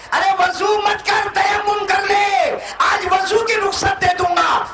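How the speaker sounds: high, slow, sustained proclamation; full-force preaching. Acted. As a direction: proclaim the ruling with long-held endings. Voices God's ruling at full force. (ऊँची आवाज़ में ठहरकर एलान)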